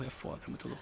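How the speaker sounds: breathy whisper, trailing off. Real, not acted. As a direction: let it fade to a whisper.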